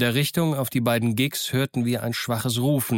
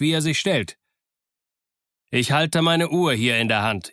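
captain addressing his crew in a raised voice; higher-pitched, emphatic command; calling out orders; 5 semitones higher than usual. A narrator's reading. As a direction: call out an ultimatum to the men. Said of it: "rief Smollett noch lauter".

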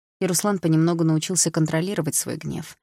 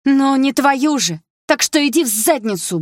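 much higher, louder, slow defiant shout; 9 semitones higher, 7 dB louder than usual. Acted. Drunk sister shouting insults at her brother. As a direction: hurl it at him.

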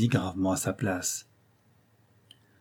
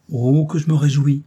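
louder, higher burst of glee; delighted exclaim; 5 semitones higher, 7 dB louder than usual. Acted. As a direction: cry out with delight. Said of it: "s'écria Dagobert". (s'écrier joyeusement, plus fort et plus haut)

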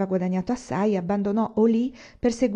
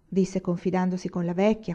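ordinary delivery

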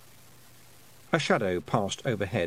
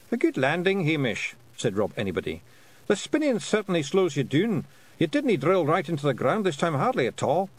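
high, lilting Scottish pictsie; Feegle leader; 7 semitones higher than usual. A narrator's reading. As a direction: praise the landing in a high Scots voice.

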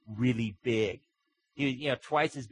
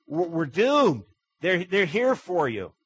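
louder, higher, stammering alarm; panicked servant; 6 semitones higher, 8 dB louder, slower than usual. Acted. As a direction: blurt it out in a panic. Panicked servant tells prophet.

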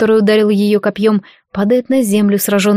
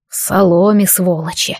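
ordinary delivery, nothing marked

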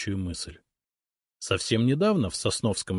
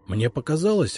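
ordinary delivery, nothing marked

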